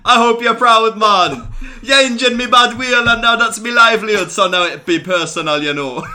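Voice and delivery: high, loud mock Jamaican promo; Jamaican accent. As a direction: belt it out in Jamaican patois. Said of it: "if it was Jamaican".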